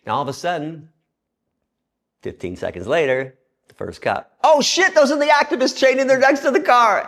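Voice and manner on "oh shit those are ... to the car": very high, loud mock panic; hammed-up high-pitched yell; alarmed shout. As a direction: cry out like a startled cop sounding the alarm. First cop's alarmed realization.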